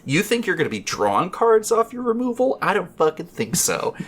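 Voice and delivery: high, incredulous retold taunt; mock taunting challenge. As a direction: throw a mock challenge at a rival player. Retold taunt to opponent in game.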